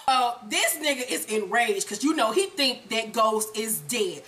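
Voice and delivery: normal talking voice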